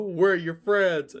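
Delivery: pleading friend. Acted.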